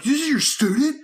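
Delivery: high, loud, indignant disbelief; mock angry. Youth worker mimicking the pastor turning on him, mock angry and incredulous. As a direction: imitate an outraged pastor demanding an explanation. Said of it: "like, really angry".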